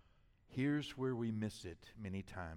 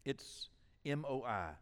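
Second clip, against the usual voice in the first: ordinary delivery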